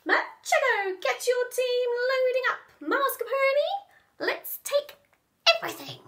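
high, loud commanding villain; mouse boss. Acted. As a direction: order the gang around. Boss orders henchmen.

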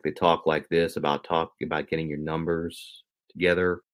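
low Southern executive drawl. Retold or imitated. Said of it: "gets, like, a Southern accent".

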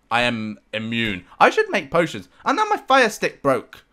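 normal talking voice

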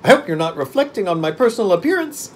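high, loud pumpkinhead character voice; gravely affronted, in a high put-on voice. A narrator's reading. Pumpkin man objecting to being laughed at, grave. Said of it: "funny to hear his grave voice".

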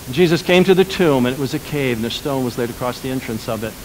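usual speaking voice

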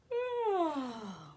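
big sleepy yawn, high and falling; acted yawn. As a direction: yawn long as if ready for bed. Acting out tiredness at bedtime.

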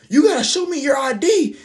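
high-pitched mock older-lady voice; put-on high voice, demanding ID; imitating the coworker. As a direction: squeak it out like a wary coworker behind a door. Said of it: "she was like".

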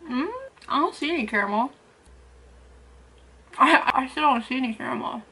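chewing while talking; talking between bites of cake; mouth full. Real, not acted.

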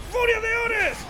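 shouted high battle cry. Real, not acted. Gamer yelling a battle cry at the enemies. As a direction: roar the move name as you strike.

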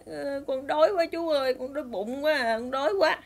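lightly acted little-boy whine; tearful hungry child. Crying little boy telling a stranger he's hungry, mild tearful whine. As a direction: plead from hunger like a crying child. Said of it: "nó ngồi, nó khóc".

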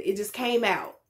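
normal talking voice

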